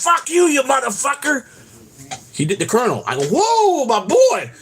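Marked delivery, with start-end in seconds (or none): 0.0-1.4 high-pitched mock yell at a driver; imitated angry yell. Colonel cursing a driver from the car window, imitated high-pitched yell. 3.3-4.5 high, whooping astonishment; retold amazed reaction. Passenger reacting to the Colonel's cursing, high amazed whoop. Own startled reaction to the outburst.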